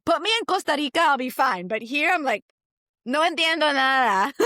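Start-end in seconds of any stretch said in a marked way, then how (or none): 3.0-4.3 high, loud comic bewilderment; mock exasperation. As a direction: play up being totally lost, higher and louder. Acts out failing to grasp the accent.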